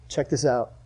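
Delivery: ordinary delivery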